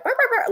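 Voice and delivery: high squeaky clucking noises; chicken clucking. Retold or imitated. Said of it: "all I could hear was chickens".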